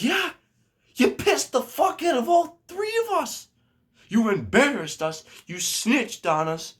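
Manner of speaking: high-pitched, heated telling-off; retold angry retort. Prankster scolds the friend for snitching, retold angry retort. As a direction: fire back high and indignant, acting it out.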